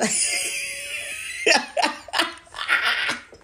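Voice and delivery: loud squealing laugh; high burst of laughter. Real, not acted. Laughing at the picture of him, squealing laugh. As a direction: crack up hard, high.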